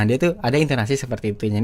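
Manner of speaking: ordinary talk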